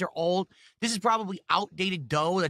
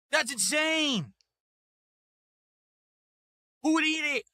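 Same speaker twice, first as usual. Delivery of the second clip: outraged outburst; 5 semitones higher than usual. Real, not acted. Reacting to the cookie's price, shouting in disbelief. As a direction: let it burst out, stunned.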